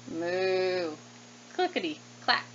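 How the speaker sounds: ordinary delivery, nothing marked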